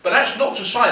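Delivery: ordinary delivery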